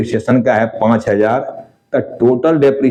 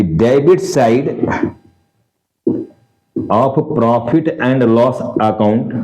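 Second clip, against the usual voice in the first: drawn-out words with a rising end; slow dictation; much slower than usual. Shown as a demonstration. Teacher telling students where to post it, slowly. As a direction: dictate it slowly, leaving room to write.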